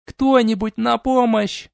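high, slow drawn-out cry; calling for help. Acted. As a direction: wail for anyone to help. Captive princess calls help.

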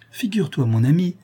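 high, eager announcement; bursting with the news; excited call to her husband; excited exclaim. A narrator's reading. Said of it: "s'écria Isabelle".

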